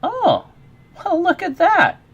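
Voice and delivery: high, loud, amazed reaction; real pleased surprise. Real, not acted. Streamer noticing a follower milestone, real surprise. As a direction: exclaim at an unexpected discovery, pitch leaping up.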